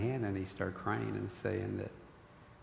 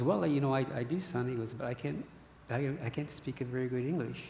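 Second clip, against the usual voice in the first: put-on higher, accented old-man voice; Spanish-accented father; 4 semitones higher than usual. Father confessing to son he can't pray in English, accented. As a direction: imitate your dad's broken English.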